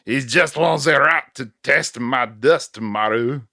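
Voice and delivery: put-on trash-talk it in your best French accent. Rival congratulates Jim with trash talk, hammed-up French accent. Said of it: "That's my best French accent".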